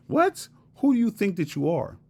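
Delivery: high-pitched, scandalized mock disbelief; mock "how dare you" outrage; mock incredulous hater. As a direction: imitate someone offended, voice leaping up high. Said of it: "This is how they look at you".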